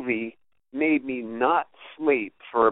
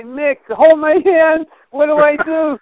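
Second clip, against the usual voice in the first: mock panic; 13 semitones higher, 10 dB louder than usual. Scared houseguest phoning a friend in New York, mock panic. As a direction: wail it like a scared caller begging for help. Retold panicked call about ghost.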